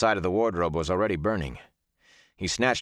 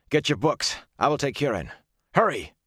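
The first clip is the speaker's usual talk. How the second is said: higher-pitched urgent commands; urgent orders; 7 semitones higher than usual. A narrator's reading. Rand to friends in a burning inn, urgent. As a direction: hurry them out, voice raised in pitch. Rand urging escape from a fire.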